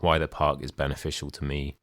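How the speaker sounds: ordinary delivery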